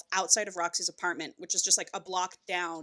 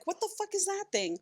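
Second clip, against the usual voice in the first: mock alarm, pitched much higher but softer; startled; 12 semitones higher, 6 dB quieter than usual. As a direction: recoil at the dog. Startled by the barking dog.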